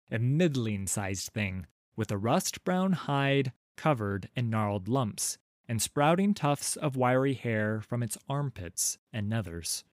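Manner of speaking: plain reading voice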